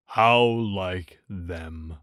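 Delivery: low, slow, mournful donkey voice; gloomy Eeyore. A narrator's reading. Narrator voicing Eeyore to Pooh, low and glum. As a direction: drag it out like a sad old donkey.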